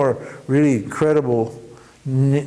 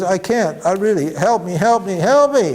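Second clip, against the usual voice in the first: desperate pleading; 6 semitones higher than usual. Retold or imitated. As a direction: beg harder each time. Terrified man begging the guru.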